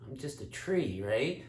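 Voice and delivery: low, soft dopey tree voice; talking tree. Acted. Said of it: "And the tree says".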